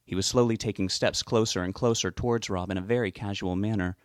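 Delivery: ordinary delivery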